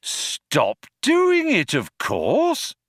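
high, slow, cheery suggestion; bright but slow. Acted. Ford advising the guard to quit, brightly but slowly. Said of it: "brightly but slowly".

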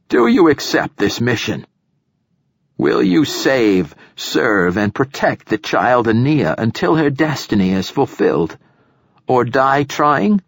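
weighty formal oath. A narrator's reading. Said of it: "his voice formal once again".

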